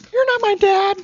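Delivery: high, loud nerdy voice; squeaky whiny nerd voice. Acted. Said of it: "Nerd voice would like to be your son".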